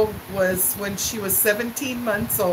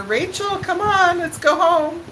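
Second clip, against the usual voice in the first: put-on high, carrying call to a toddler; 7 semitones higher than usual. Mother calling her toddler to go home, high call. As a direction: holler across the playroom. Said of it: "So I'm hollering at her".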